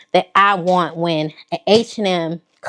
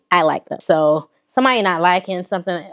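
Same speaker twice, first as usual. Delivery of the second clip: usual speaking voice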